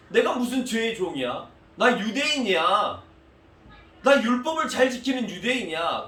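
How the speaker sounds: high, loud, proud retort; arguing indignantly. Acted. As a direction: argue back hard, wounded pride. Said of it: "라고 지금 예수님 앞에 따지는 겁니다".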